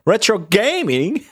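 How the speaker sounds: drawn-out Aussie TV quip; a mock Australian presenter asking; imitating Australian TV. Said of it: "that Australian T V video".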